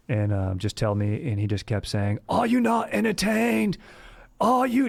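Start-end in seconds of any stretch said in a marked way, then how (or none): 2.3-4.9 high, drawn-out taunting yell; mock gladiator yell. Older brother taunting pinned sibling, hammed-up yell. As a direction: taunt him like a gladiator crowd-baiter. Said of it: "Just yelling that into my ear".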